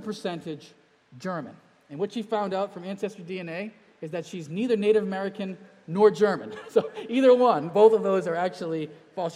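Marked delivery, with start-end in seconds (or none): 4.5-9.3 loud, laughing reveal of the punchline; laughing excitement. Real, not acted. Pastor laughing loudly as he tells the congregation about his wife's DNA result. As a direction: burst out laughing at the surprise.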